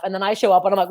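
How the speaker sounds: normal talking voice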